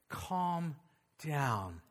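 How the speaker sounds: husband soothing his wife, hushed and low; mock loving calm. Shown as a demonstration. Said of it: "look at her very lovingly and just say".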